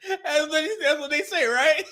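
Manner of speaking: high laughing talk. Real, not acted. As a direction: laugh through the words. Still cracking up.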